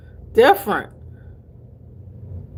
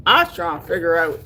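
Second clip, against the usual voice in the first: usual speaking voice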